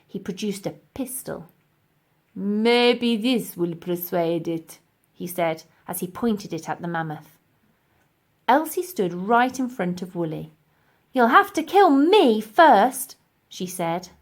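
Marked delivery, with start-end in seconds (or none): 2.3-4.8 slow, sinister professor; menacing. Acted. Professor pointing a pistol at the mammoth, slow menace. 11.1-13.1 slow, loud, high defiance; defiant. Acted. Elsie shielding Woolly, loud defiant voice.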